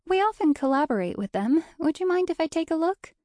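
high, bright, sweet politeness; tinkling brightly. A narrator's reading. Guide angling for the rival's brochure, tinkling brightly. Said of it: "she tinkled brightly".

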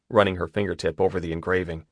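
plain reading voice

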